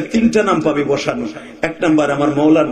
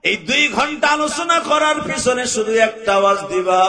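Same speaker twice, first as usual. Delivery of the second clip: high ringing chanted call; rally the crowd with a sung cry; preaching at full force; 12 semitones higher than usual. Acted. (জোরালো সুরে ওয়াজ)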